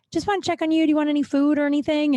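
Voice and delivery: fake-casual server voice. Waitress pretending to check on the customer, faux-casual. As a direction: sound breezy to sneak a look.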